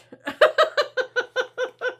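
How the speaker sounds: high, loud giggling fit; can't stop giggling; high giggle. Real, not acted. Laughing fit.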